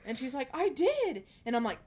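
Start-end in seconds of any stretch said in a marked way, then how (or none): 0.6-1.2 put-on high, loud child protest; high insistent child. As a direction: whine it like a kid. Child voiced in story.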